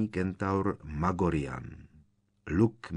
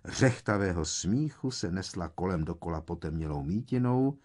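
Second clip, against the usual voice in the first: ordinary delivery, nothing marked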